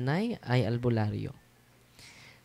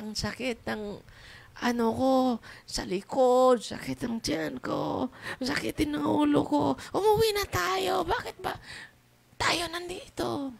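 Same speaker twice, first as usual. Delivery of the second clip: much higher, whiny voice listing her pains; complaining pregnant woman; 13 semitones higher than usual. Retold or imitated. Pregnant woman begging her family to go home, high and whiny. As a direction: plead like a sick woman begging to leave. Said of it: "Sabi niya".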